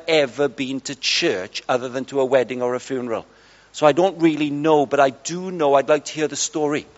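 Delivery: low, soft-spoken reported voice; imitating a shy man's hushed speech; quiet man. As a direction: voice a reserved man, softly and low. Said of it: "He's a very quiet man".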